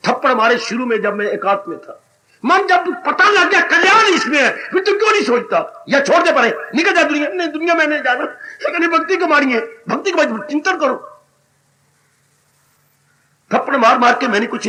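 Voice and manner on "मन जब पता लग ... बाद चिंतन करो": inner voice arguing. Retold or imitated. Preacher voices his arguing mind.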